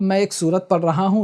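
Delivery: ordinary delivery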